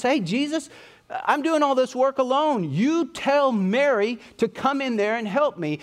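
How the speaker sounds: high, exasperated whine at Jesus; annoyed complaint. Retold or imitated. Martha complaining to Jesus about doing all the work, high and exasperated. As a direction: complain like a fed-up sister. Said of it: "And so she gets mad".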